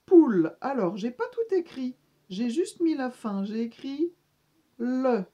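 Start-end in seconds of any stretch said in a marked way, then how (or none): 0.0-0.6 "poule" called out much louder; loud teaching word. Shown as a demonstration. Teacher presenting the word "poule" on the drawing. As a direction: call out "poule" for the class. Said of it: "C'est la".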